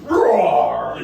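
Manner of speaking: loud growling roar; orc growl. Retold or imitated. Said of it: "doing his best orc growl".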